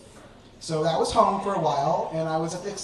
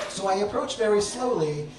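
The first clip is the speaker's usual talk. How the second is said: ordinary delivery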